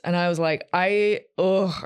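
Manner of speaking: ordinary talk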